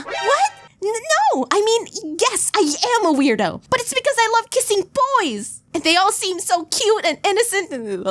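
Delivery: high, defensive cartoonish voice; stammer, then confess in a high put-on voice; flustered weirdo. Boy Kisser answering if he's a weirdo, high and flustered.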